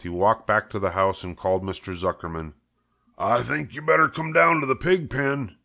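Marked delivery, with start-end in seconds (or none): 3.2-5.6 a somewhat higher, uneasy farmhand voice; farmhand Lurvy, lightly voiced. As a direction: call the boss down, unsettled. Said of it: "he said".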